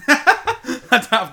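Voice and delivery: slow and loud through laughter; laughing. Real, not acted. Joking about the show's budget, a slow, loud laughing reply. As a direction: laugh it out slowly.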